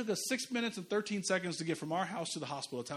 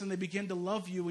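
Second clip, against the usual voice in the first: usual speaking voice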